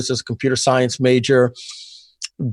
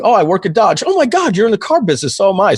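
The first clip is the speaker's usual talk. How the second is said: high, loud amazed reply; excited discovery; 8 semitones higher, 3 dB louder than usual. Retold or imitated. Telling how he and Joe both sold cars, high excited astonishment. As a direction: shriek the coincidence in delight. Discovering they share car business.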